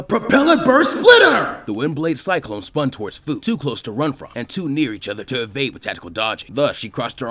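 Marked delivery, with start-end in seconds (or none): 0.0-1.6 rising into a big shout on the attack name. A narrator's reading. Narrator calling out the attack with a shout. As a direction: call the jutsu out at full volume.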